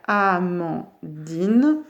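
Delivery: Amandine slowly cut as A, man, dine; split into syllables. Shown as a demonstration. Teacher giving an example to pupils, Amandine syllable by syllable. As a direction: stretch each of the three syllables in "Amandine". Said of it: "il y a trois syllabes".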